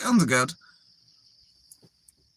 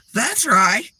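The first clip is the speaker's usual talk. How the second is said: louder, higher, emphatic agreement; imitated tone change; 5 semitones higher, 5 dB louder than usual. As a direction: mimic someone jumping right on board. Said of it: "That tone she just used".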